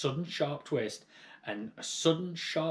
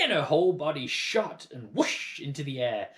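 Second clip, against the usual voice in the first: ordinary delivery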